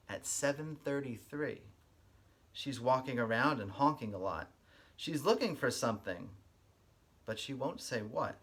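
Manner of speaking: lively storybook read-aloud with a sing-song lilt; reading to children. Acted. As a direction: read it like story time for little kids. Reads picture book aloud.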